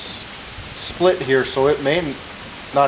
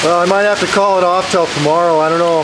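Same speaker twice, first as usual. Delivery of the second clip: talking loudly over storm wind; raised voice to carry outside; 6 semitones higher, 6 dB louder than usual. Real, not acted.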